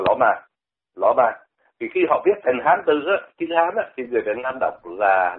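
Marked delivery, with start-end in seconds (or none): 0.1-1.3 Lõ Ma with an L in place of the R, two Vietnamese syllables; Chinese-style Roma. Shown as a demonstration. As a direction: swap the R of Roma for an L. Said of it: "Họ đọc là".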